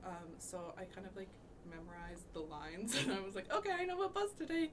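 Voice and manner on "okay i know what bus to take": excited. Retold or imitated. Quoting her own thought, excited.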